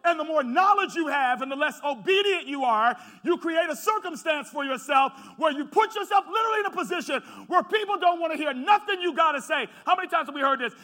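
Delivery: loud, rising pulpit cadence; preaching at full force. Acted. Pastor to congregation, preaching at full force. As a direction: drive the point home to the congregation.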